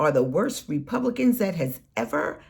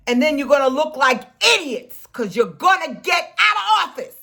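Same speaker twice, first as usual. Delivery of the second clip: louder, higher, faster shout; angry shout; 6 semitones higher, 4 dB louder, faster than usual. Real, not acted. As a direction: blast it at them. Ranting at Republican politicians.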